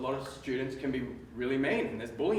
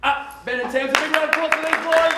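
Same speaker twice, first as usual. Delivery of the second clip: much higher and louder, like a game show host; hyped-up announcer; 12 semitones higher, 11 dB louder than usual. Acted. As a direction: sell the applause to the whole room. Hyping the crowd for applause.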